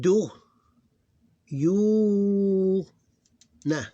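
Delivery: "Do, you, nah" with a long high "you"; short Do, long-held loud you, short nah; extended syllable. Shown as a demonstration. Teacher reading line three for students, syllable by syllable, extended. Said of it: "this is extended".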